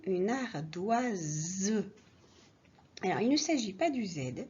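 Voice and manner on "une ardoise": une ardoise said very slowly; each sound of ardoise stretched for clarity. Shown as a demonstration. Teacher slowing down so pupils hear the S. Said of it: "Et alors attention".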